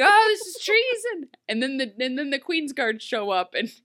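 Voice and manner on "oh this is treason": high, loud "treason" gasp; hammed-up royal shock; mock indignation. Regina catching the axe game, hammed-up shock. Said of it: "And she's like".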